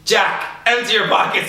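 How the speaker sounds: high mock-stern teacher order; hammed-up strict teacher. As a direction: bark the order at the other boy. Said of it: "And she's like".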